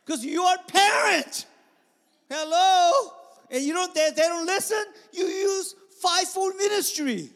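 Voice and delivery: high, shouted exhortation; full-force preaching. Real, not acted. Preacher telling parents to take charge, full-force preaching. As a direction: drive it home loudly to the congregation.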